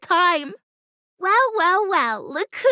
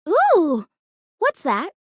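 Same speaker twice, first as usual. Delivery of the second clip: normal talking voice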